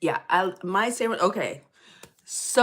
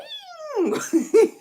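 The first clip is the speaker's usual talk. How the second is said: squealing laugh up high; high burst of laughter; 6 dB louder than usual. Real, not acted. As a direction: laugh out loud at yourself. Laughing at her own rant.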